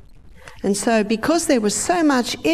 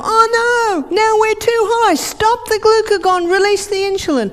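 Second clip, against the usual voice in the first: much higher, louder panic; panicking brain; 12 semitones higher, 4 dB louder than usual. Acted. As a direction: brain flapping in alarm. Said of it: "What does the brain go".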